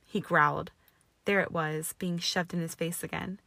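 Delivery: ordinary delivery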